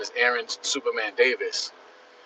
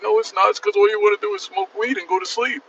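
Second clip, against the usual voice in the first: higher, louder mock ex-girlfriend; mock girlfriend retort; 9 semitones higher, 3 dB louder than usual. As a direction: throw it back like an annoyed girlfriend. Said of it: "It's like".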